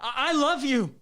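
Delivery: loud plea. Acted. Pleading confession after breakup fight.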